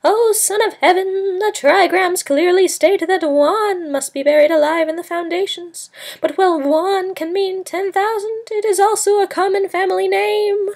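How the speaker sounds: very high, loud soothsayer cry; a bribed soothsayer proclaiming loudly in a high voice; bellowing soothsayer. A narrator's reading. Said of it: "he bellowed".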